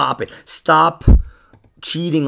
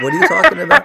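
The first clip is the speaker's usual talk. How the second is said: very high squealed question; mock moose call; 5 dB louder, much faster than usual. Real, not acted. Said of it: "Are you doing a moose call again?".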